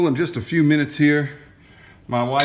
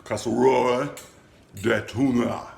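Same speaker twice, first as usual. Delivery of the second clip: mock French accent. Shown as a demonstration. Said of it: "how to say tuna in French".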